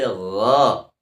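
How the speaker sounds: slow, heavy ghain sound; thick gha. Shown as a demonstration. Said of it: "Dibaca tebal". (gha dibaca tebal)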